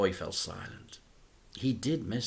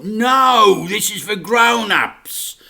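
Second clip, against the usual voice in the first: firm refusal; 10 semitones higher, 14 dB louder, slower than usual. A narrator's reading. Dad refusing firmly.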